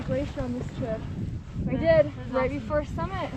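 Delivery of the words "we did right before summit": ordinary talk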